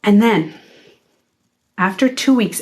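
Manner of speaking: ordinary delivery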